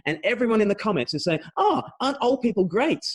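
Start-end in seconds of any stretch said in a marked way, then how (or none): 1.6-3.0 high-pitched cooing admiration, imitated; mock gushing. Commenters praising old people on Facebook, mock gushing. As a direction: gush like an adoring commenter.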